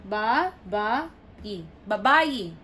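"Babae" syllable by syllable, then whole as ba-BA-e; stress on second syllable. Shown as a demonstration. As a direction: count out "Babae", landing on the second BA. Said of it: "The stress is in the second syllable".

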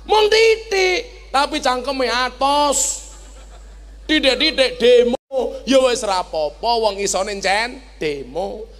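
ordinary talk

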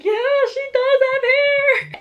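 imitating an excited dad, very high; loud, drawn-out squealed agreement; thrilled squeal. Partner confirms baby has hair, thrilled squeal.

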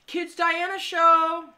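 excited. Real, not acted. Excited reading of channel name.

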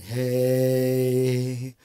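loud, doubled hey; doubled voice demo. Shown as a demonstration. Teacher calls a loud hey to demo doubling. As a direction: shout one hey through the doubler. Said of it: "Các bạn có thể để nitro chẳng hạn".

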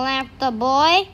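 ordinary delivery, nothing marked

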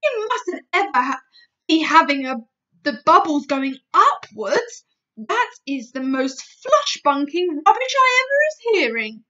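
indignant booming giant; giant crying out. Acted. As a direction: insist loudly, offended. BFG rants at Sophie.

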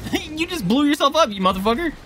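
gleeful shout. Real, not acted. Enemy blew himself up.

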